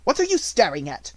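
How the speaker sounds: irritated schoolmistress losing patience; sharp demand. A narrator's reading. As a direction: bark it sharply. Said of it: "demanded Miss Minchin sharply".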